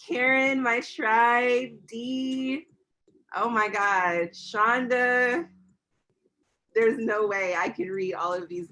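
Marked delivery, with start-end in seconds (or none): none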